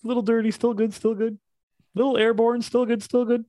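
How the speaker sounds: chirpy cartoon dad insisting it's fine; Homer quote. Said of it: "and it's like".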